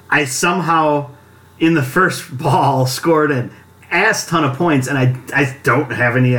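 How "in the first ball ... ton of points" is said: usual speaking voice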